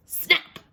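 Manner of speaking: a sharp loud snap. Acted. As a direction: bite the word off like jaws closing. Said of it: "the sound the mouth is making".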